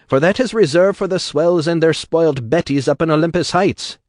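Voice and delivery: loud, high Irish rabble-rouser; Irish brogue bellow. Acted. Atlas harangues the crowd in a loud Irish brogue. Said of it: "in something like an Irish brogue".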